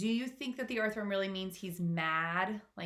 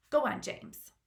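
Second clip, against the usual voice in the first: gentle ladybug; 8 semitones higher, 4 dB louder than usual. Acted. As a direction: coax him gently. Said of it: "said the ladybug gently".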